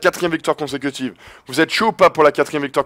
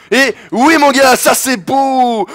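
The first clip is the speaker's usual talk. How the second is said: excited cheer; 8 semitones higher, 4 dB louder, much slower than usual. Real, not acted. Celebrating a basket.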